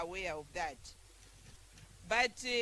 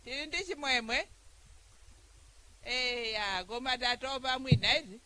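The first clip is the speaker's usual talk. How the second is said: rallying the crowd; 3 semitones higher, 5 dB louder than usual. Real, not acted. Rallies a tired crowd.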